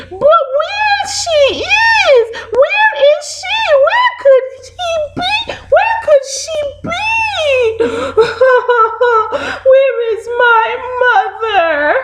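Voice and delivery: very high crying chick calling out; hammed-up high wail of a lost baby bird. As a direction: sob and call for mommy. Baby bird cries for mother.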